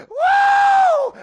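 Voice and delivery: high, loud whooping cry; ecstatic woo. Real, not acted. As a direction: let out a joyful holler.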